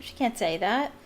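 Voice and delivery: ordinary delivery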